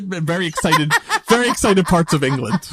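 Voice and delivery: talking through laughter; amused, laugh breaking into the words. Real, not acted. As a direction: answer while cracking up at your own joke. Cracking up at own accent.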